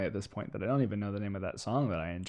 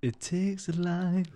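sultry narrator; 5 semitones higher, 3 dB quieter, slower than usual. Pretend. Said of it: "let's get some Swano sultry".

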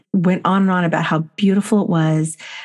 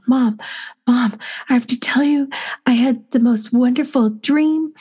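little daughter's voice; 6 semitones higher than usual. Retold or imitated. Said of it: "she said".

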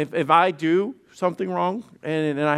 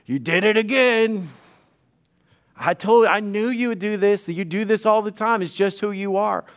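higher-pitched mock accusing outburst; acted accusation; 4 semitones higher than usual. Spouse in an argument after forgiving, acted accusation. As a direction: throw the old offence back, voice raised in pitch. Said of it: "Then an argument comes up".

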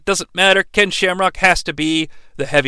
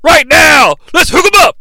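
loud, high announcer-style holler; punchy impersonated yell; Cowboy Bill Watts; 7 semitones higher, 5 dB louder than usual. Retold or imitated. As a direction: shout it as a rousing wrestling catchphrase. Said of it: "as Cowboy Bill Watts would say".

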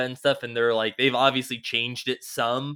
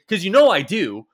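loud, pitched-up retold boast; mock boasting; 6 semitones higher, 7 dB louder than usual. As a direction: act out a gleeful admission with a laugh. Acting out killer's boast.

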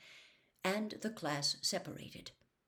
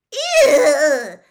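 high, very loud laughing squeal of disgust; 11 semitones higher, 21 dB louder than usual. A narrator's reading. Lavender recoils from the crates with a loud squeal. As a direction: shriek it and leap back. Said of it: "squealed Lavender Brown".

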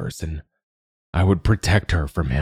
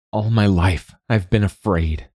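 ordinary delivery, nothing marked

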